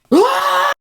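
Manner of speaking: high loud mock scream; a high mock yell with no words; imitated wordless scream. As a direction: scream like an angry customer. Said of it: "he just starts screaming at me".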